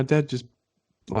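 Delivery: normal talking voice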